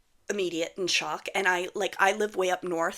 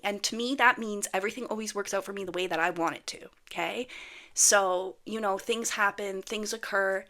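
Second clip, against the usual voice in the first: ordinary delivery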